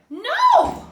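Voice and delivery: very high, loud defiant no; shout. Acted. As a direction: shout back hard. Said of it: "I shouted back".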